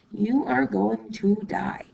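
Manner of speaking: normal talking voice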